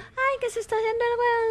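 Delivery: lightly mocking quoted voice; mimicking a colleague. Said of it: "él te dijo".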